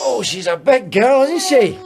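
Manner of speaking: put-on high, slow cooing to the baby; baby talk. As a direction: coo over the baby like a doting uncle.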